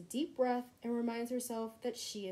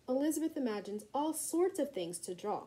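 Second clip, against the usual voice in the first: ordinary delivery, nothing marked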